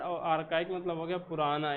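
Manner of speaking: usual speaking voice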